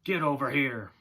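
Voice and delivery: loud, cutting order; sharp commanding shout. A narrator's reading. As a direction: call out hard, cutting through the air. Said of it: "cut through the air like the twang".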